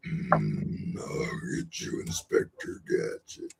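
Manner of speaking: low, slow, whispered villain growl; slow unvoiced supervillain rasp; Doctor Claw. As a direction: menace Inspector Gadget from the shadows. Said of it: "do my Doctor Claw".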